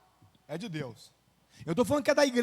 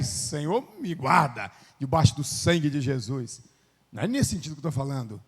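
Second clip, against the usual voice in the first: ordinary talk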